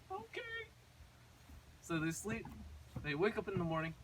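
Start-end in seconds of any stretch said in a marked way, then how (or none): 0.1-0.4 very high, small, soft voice; acted little-girl whimper; tiny whimpering reply. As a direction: squeak it like a tearful little girl. Crying Gretel answers brother's comfort.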